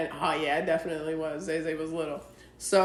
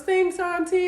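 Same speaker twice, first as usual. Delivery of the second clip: little niece; 11 semitones higher, slower than usual. Retold or imitated. Aunt voices her little niece.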